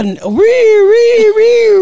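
high, loud ree-ree siren; siren imitation. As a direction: wail like an ambulance. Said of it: "I hear".

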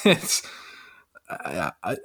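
fading away mid-thought; trailing off. Real, not acted. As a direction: lose the thread and drop off very softly. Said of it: "I lost my train of thought".

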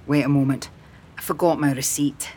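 hint of a man, low and casual; lightly voiced man. A narrator's reading. Man's lines in story narration.

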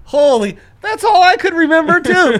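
squeaky excited outburst; high-pitched excited yelp. Real, not acted.

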